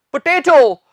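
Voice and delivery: पोटैटो with a long "tay" middle; correct pronunciation. Shown as a demonstration. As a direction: say पोटैटो as "puh-TAY-toh". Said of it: "इसका सही accent है".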